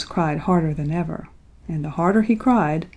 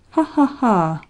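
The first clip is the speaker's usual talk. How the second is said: storybook fox's "ha, ha, ha"; pronounced mocking laugh, slightly lifted; acted laugh; 3 semitones higher than usual. A narrator's reading. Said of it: "laughed Reddy Fox".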